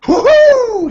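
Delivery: high loud victory whoop; celebratory screech. Acted. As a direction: whoop like you just won a bet. Orin wins bet, celebratory screech.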